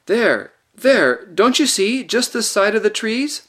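put-on a girl urgently pointing out the lion; insistent pointing. A narrator's reading. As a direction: make him see it, pressing hard.

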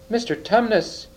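high-pitched call, only mildly bawled; understated storybook bawl. A narrator's reading. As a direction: call the name sharply to get through to someone. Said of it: "bawled Lucy in his ear".

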